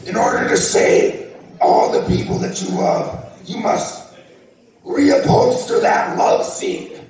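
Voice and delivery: menacing villain voice, drawn out; booming arrogant alien overlord. Pretend. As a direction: threaten like a cartoon overlord. Overlord sets his challenge.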